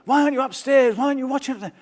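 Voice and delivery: high, girlish mock voice; girlish high-pitched imitation; mimicking the girls. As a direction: squeak it up like the dancers teasing him. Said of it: "the girls would come to me and go".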